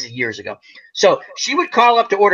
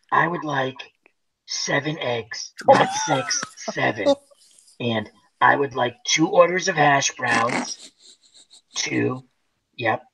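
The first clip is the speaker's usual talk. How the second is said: 1 ladylike voice; 5 semitones lower, 5 dB quieter, slower than usual. Retold or imitated. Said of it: "really nice, ladylike voice".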